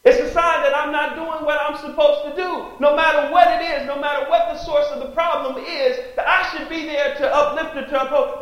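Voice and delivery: loud, high impassioned preaching. Real, not acted. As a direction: preach it with fire to the congregation. Preacher at full force.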